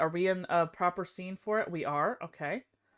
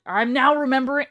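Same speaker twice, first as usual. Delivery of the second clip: ordinary talk, nothing marked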